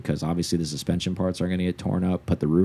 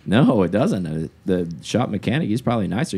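usual speaking voice

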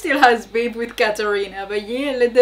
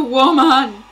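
exasperated shout; 2 semitones higher, 8 dB louder than usual. Real, not acted. Exasperated at show character.

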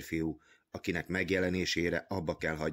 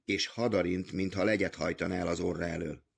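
ordinary delivery, nothing marked

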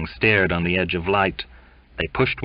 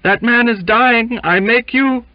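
high, loud, desperate plea; frantic, high-pitched protest; desperate cry; 8 dB louder than usual. A narrator's reading. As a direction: cry out as a last appeal. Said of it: "he cried, desperately".

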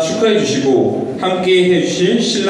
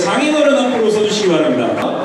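normal talking voice

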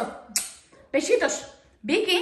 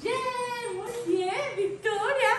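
cooing praise; 9 semitones higher, much slower than usual. Real, not acted. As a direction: praise your bird in a small, high voice. Praising the parrot.

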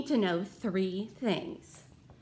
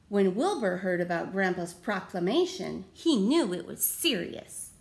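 usual speaking voice

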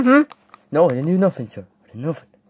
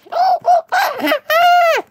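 shrill high cackle. Acted.

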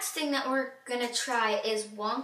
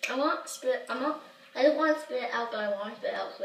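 talking while sucking candy; candy in mouth; 4 dB louder than usual. Real, not acted. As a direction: sour face puckered mouth. Seen 0: Dark-haired girl puts candy in mouth, then grimaces sourly while talking.

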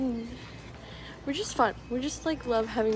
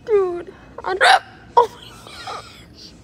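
high, loud helpless laughter; laughing through hiccups; 9 semitones higher, 7 dB louder than usual. Real, not acted. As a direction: laugh till you almost cry. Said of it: "I'm gonna start crying".

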